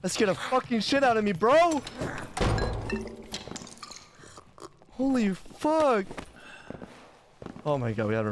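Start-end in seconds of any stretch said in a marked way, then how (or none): 0.0-6.1 shaken shouting. Real, not acted. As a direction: blurt it out still rattled. After scare, shouting.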